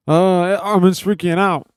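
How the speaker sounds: loud, high, drawn-out mock announcement; mock alarm. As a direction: blare it out slowly like a panicked alert. Mimics frantic phone news alerts.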